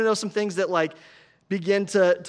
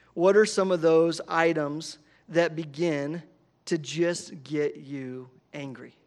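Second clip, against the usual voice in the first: ordinary talk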